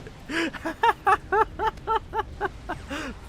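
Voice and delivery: long, high staccato laugh; helpless high-pitched giggling burst; high cackle. Real, not acted. Caster laughs as the Novax dies right after his call, high cackle.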